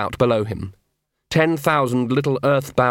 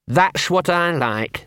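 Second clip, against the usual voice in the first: slow, higher-pitched bitter dog; 6 semitones higher, much slower than usual. Acted. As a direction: draw it out with sour sarcasm. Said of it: "said Gaspode bitterly".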